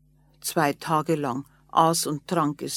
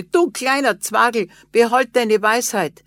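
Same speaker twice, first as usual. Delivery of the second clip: louder, higher mocking farmer; mocking laugh-in-voice; 4 semitones higher, 6 dB louder than usual. A narrator's reading. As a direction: brush the dwarf off with a scoff. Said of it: "Da lachte der Bauer und sagte".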